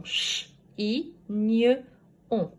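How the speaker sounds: "Ch, i, gne, on" as four separate sounds. Shown as a demonstration. Teacher proving there are four sounds, "Ch, i, gne, on" spoken apart. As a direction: sound out chignon piece by piece. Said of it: "mais quatre sons".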